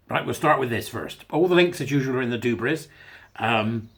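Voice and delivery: ordinary talk, nothing marked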